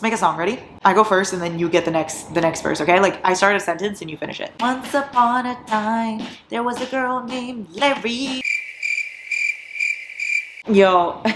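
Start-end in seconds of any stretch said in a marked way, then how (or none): 4.6-8.4 storybook opening. Pretend. Starting a make-a-song game.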